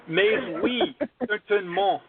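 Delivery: a mock French accent laid on thick on purpose; hammed-up French. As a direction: butcher the French like a cheesy Frenchman. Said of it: "I'm butchering it as much as I can".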